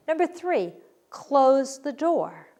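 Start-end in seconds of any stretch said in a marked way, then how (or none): 1.1-2.5 slow, firm, louder order; commanding. Shown as a demonstration. Teacher showing what a command sounds like, firm order. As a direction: say it like an order to the class. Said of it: "I'm giving a command".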